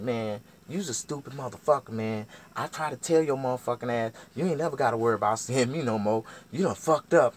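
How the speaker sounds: loud, high scolding; going off. Retold or imitated. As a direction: act out her chewing him out. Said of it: "she going off on me".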